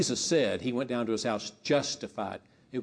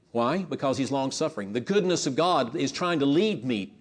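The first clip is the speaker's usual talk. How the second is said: ordinary talk, nothing marked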